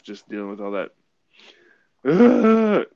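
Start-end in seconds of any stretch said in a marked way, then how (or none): 2.0-2.9 pained groan. Real, not acted. Reacting to pain of labour.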